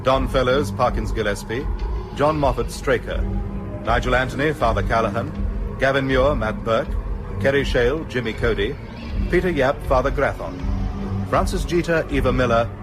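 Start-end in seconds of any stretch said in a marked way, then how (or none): none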